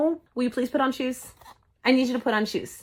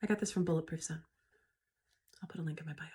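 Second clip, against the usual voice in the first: whispering; 7 semitones lower, 13 dB quieter, faster than usual. Real, not acted. Sombre aside after shield talk.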